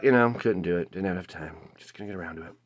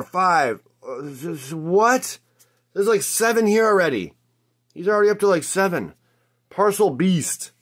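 high-pitched, incredulous hype; worked up and disbelieving; amazed excitement; 6 semitones higher than usual. Real, not acted. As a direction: marvel at an absurd lucky streak. Counting an unbelievable pile of pulls.

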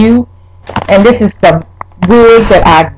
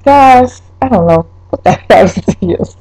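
normal talking voice

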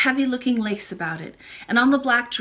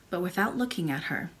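usual speaking voice

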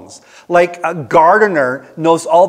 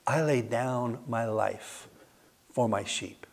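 hushed solemn quote; 8 semitones lower, 16 dB quieter than usual. Acted. Jesus quoted at sermon climax.

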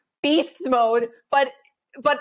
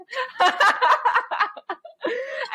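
laugh; 12 semitones higher than usual. Real, not acted. Laughing at her own story.

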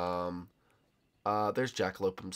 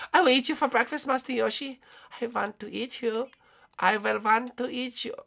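high put-on cartoon voice; high-pitched mock predator; hungry villain character; 12 semitones higher than usual. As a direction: menace your prey in a high voice. Plays the animatronic hunter.

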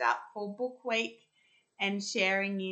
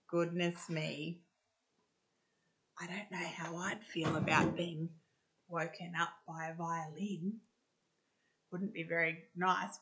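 ordinary delivery

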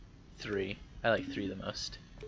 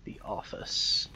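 whispering; 3 dB quieter, slower than usual. Real, not acted. Reading aloud to self.

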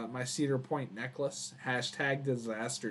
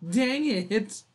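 imitating his kid voice; 9 semitones higher, 6 dB louder than usual. Mimics his childhood self from clip.

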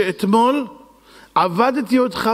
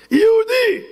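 shocked high yelp; much slower than usual. Acted. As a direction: squeal it in disbelief. Host shocked at guest's name.

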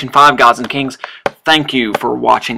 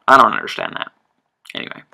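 ordinary talk

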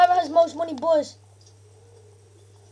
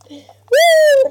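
high whoop; 11 semitones higher, 11 dB louder than usual. Real, not acted. Cheering in game.

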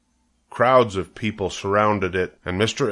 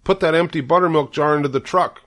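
high, forceful order; commanding; 8 semitones higher, 4 dB louder than usual. A narrator's reading. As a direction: give a brisk order to a helper. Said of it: "commanded Mister Arable".

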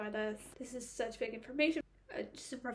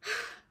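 high, weary sigh; a fed-up sigh about the story; exasperated sigh; 11 semitones higher, 3 dB louder than usual. Real, not acted. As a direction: let out a long fed-up sigh. Sigh of frustration.

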